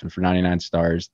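ordinary delivery

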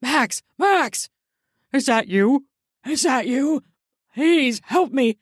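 calling out high across the lake, half-hearted; desperate shout. Acted. Trapped man calls to his friend, a hammed-up shout. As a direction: cry for help to a distant friend. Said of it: "he shouted".

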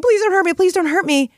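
high, pleading mock scream; retold screaming plea. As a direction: relive begging a gunman, without a full scream. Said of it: "I'm screaming".

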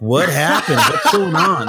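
stunned and laughing at once; laughing disbelief. Retold or imitated. Scott asks Brad in shock, laughing.

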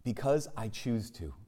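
soft, slow, measured reply; hushed, unhurried certainty; God's quiet answer. Retold or imitated. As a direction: answer gently and deliberately. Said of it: "the answer came back".

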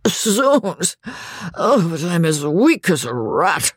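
affected drawl strained by weakness; weak and suffering. A narrator's reading. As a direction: groan it out in a foppish drawl. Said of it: "strange accent of weakness and of suffering".

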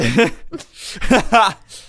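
high burst of laughter; laughing. Real, not acted. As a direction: break into a laugh.

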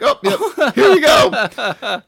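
very high, playful clerk voice; mock-casual, high and drawn out; imitated oblivious clerk. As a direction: breezily wave it through in a comic high voice. Hoped-for clerk reaction, acted out.

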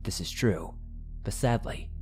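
plain reading voice

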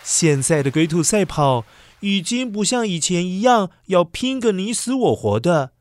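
ordinary delivery

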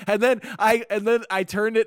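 ordinary delivery

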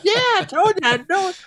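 high, fast told-you-so gloat; proving teammates wrong, high quick gloating voice. Retold or imitated. Boasting to teammates, retold.

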